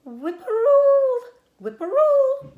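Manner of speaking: high, loud, slow whistled bird call; birdsong call. A narrator's reading. A bird calls from across the road, high whistled birdsong. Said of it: "a bird sang".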